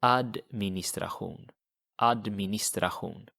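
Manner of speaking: Administration Swedish style, with a broad a and a throaty hoon ending; Swedish pronunciation. Shown as a demonstration. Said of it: "how would you say that?".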